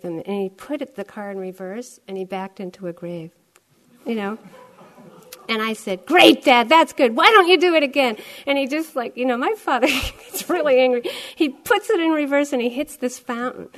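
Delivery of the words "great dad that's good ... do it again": higher, louder, fast mocking jab; sarcastic egging on. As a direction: needle Dad with sarcasm. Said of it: "I was just egging him on".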